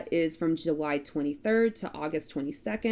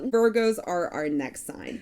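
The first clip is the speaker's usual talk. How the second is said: ordinary talk, nothing marked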